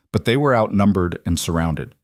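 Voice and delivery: usual speaking voice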